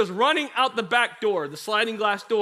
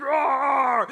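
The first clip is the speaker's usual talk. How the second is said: raised, teasing mock roar; lion roar; 6 semitones higher, 5 dB louder than usual. Brother on the floor pestering his sister, mock lion roar. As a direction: imitate a lion to pester her. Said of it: "roaring like a lion".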